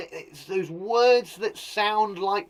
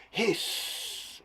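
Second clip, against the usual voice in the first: long sibilant hiss on the end; drawn-out, airy snake hiss; 4 semitones higher, 4 dB quieter than usual. Shown as a demonstration. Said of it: "sounds like the way that a snake hisses".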